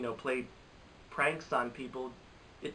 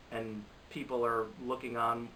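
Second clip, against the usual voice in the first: ordinary delivery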